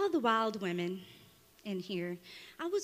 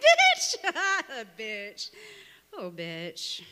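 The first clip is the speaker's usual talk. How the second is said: high, loud, drawn-out exclamation; acted retort; 3 semitones higher, 6 dB louder, much slower than usual. Poet retorting to the man who called her that, loud exclamation. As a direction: throw the word back high and loud. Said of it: "And then I was like".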